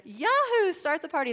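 loud, high whoop of celebration; jubilant cheer. Acted. Followers at the tomb whooping it up, mock-jubilant. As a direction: cheer as if a party is starting.

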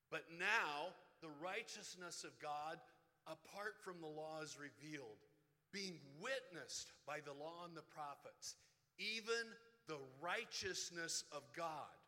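slow and loud, each phrase laid out; slow, loud pulpit reading; slow scripture reading. Shown as a demonstration. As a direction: read the verse slowly to the congregation so it sinks in. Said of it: "I'm gonna read it slow".

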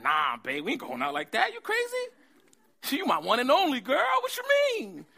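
high, loud shocked refusal with a laugh; mock outraged Abraham. Husband refusing his wife's plan, in loud, high mock outrage. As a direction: act scandalized at the idea.

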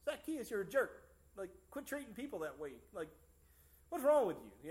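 high mock scolding, rising to a yell; hammed-up stern God. God scolding Zacchaeus, mock harsh. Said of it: "God walking up to Zacchaeus and saying".

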